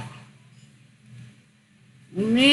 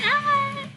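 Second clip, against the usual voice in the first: imitating his scream; 4 dB quieter than usual. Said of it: "He's like".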